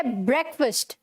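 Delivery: ब्रेकफास्ट said "BREK-fust"; short vowels in both halves of ब्रेकफास्ट; correct pronunciation. Shown as a demonstration. Teacher modelling for students, ब्रेकफास्ट as "BREK-fust". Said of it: "फास्ट नहीं है".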